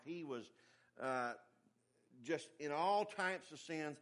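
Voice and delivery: ordinary delivery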